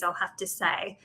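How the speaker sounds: ordinary talk, nothing marked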